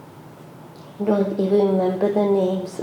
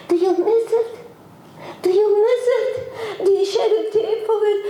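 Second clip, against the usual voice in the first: high, quick, half-laughing, half-crying plea; tearful preacher pressing the congregation; voice breaking; 11 semitones higher, faster than usual. Real, not acted. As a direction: let the tears break through the question. Preacher's emotion breaking through.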